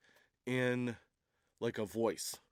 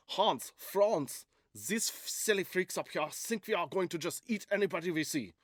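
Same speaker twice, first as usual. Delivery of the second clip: high-pitched cartoon German accent; 6 semitones higher than usual. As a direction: play a German shepherd with a thick German accent. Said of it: "I wonder if they're German".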